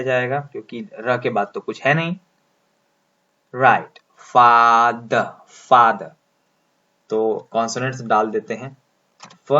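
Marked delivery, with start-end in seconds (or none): 4.2-6.1 फाद said slowly with a long aa and no final r; model pronunciation. Shown as a demonstration. Teacher modelling the word for students, slow and louder. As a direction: stretch फाद, long open vowel, drop the r. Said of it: "बताइए तो ये कैसे होगा".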